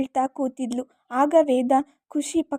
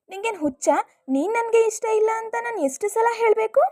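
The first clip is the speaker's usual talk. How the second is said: put-on higher, irritated young girl's voice; annoyed girl; 7 semitones higher than usual. Khushi tells Dhruva off, higher and irritated. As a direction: snap back like a fed-up girl.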